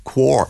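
"Quark" with a strong kw start; posh Quark. Shown as a demonstration. Coaching a posh Cork, Quark with a Q. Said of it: "we're making more a Q".

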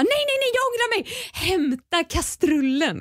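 high, hurried backtracking; frantic change of mind. Retold or imitated. Shouting to Kristoffer to fetch the pot instead, frantic and high. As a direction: call it off in a panic. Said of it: "och jag bara".

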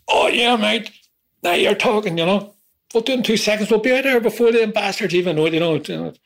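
high keen Liverpool mate; cheeky Ronnie. Retold or imitated. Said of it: "So I put it to him".

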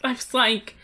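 usual speaking voice

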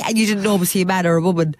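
ordinary delivery

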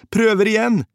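high, loud shouted reply; urgent roar. A narrator's reading. Soldier to drowning comrade, high urgent roar. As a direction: shout it over the roaring river. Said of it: "brølte jeg".

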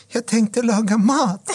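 high, drawn-out mock pleading; puppy-eyed girlfriend. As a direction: imitate her squeaky puppy-eyed plea, slowly. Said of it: "hon sitter med sina hundögon".

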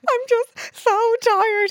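high, slow, put-on weepy whimper; mock teary whine. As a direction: whine it out like you're about to cry. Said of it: "I was like".

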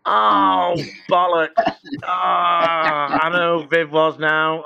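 the penny drops too late; sudden realization. Retold or imitated. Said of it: "she kind of went".

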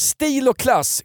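slow, lofty mock-classy delivery; mock posh. Podcast host mocking car salesmen, slow and lofty. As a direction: savour the words like a snob pretending to class.

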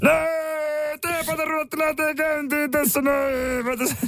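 very high-pitched shouty character voice; put-on tepoilmies voice pushed way up high. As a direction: screech it out in a sustained high comic voice. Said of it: "yhden aamun vedät tolla äänellä".

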